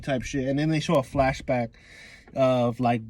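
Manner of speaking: ordinary talk, nothing marked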